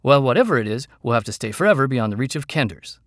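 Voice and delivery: loud, irritated retort; irritable snap. A narrator's reading. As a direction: cut them off with loud impatience. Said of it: "Tanis snapped irritably".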